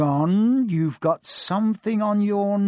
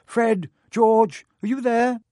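mother calling loudly from outside; 9 semitones higher, 6 dB louder, slower than usual. A narrator's reading. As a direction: call to the boys from the platform. Mother calls from platform.

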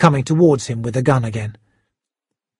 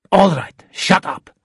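interrupting; 4 semitones higher than usual. A narrator's reading. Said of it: "The shark interrupted".

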